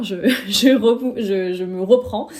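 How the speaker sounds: laughing at herself. Real, not acted. After botching English, laughing and correcting herself. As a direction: laugh at your own slip and correct yourself.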